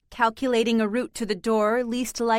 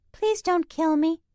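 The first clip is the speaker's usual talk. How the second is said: high cat voice; 7 semitones higher than usual. A narrator's reading. Cats mimic in varied voices.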